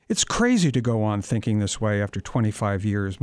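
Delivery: ordinary delivery, nothing marked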